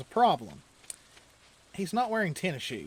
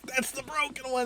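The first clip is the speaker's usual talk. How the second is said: hurt brother protesting; 9 semitones higher than usual. Retold or imitated. Brother telling doctors wrong wrist.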